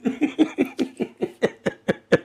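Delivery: high, breathless giggling fit; wheezy laughter. Real, not acted. Host cracking up on the stream, wheezing. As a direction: laugh helplessly, almost without voice. Helpless laughing at own bit.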